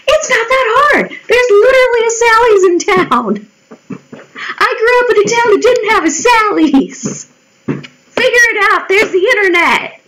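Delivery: high-pitched comic exasperation; exasperated rant. Retold or imitated. Answering people asking about hair dye, high exasperated rant. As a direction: pitch it way up, fed up with the question.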